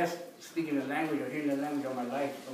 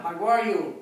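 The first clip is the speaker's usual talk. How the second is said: loud, crisp-ending call to a group; 3 semitones higher, 9 dB louder, slower than usual. Real, not acted. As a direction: call it out for everyone to repeat. Leading group call and response.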